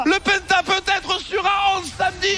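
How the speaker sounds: rising, shouted play-by-play hype; esports caster. Real, not acted. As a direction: build the hype as the kills land.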